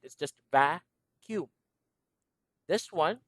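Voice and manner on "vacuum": vacuum slowly, VAK-yoom; slow careful pronunciation. Shown as a demonstration. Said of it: "When you pronounce it".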